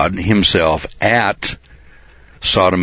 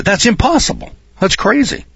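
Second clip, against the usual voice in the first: much higher mock voice of a doubter; scoffing average guy. Preacher mocking the doubting average guy, high scoffing voice. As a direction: toss it off like a sceptic who won't believe. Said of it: "the average guy would look at that and say".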